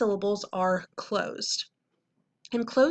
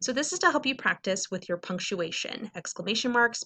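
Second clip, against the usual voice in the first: ordinary delivery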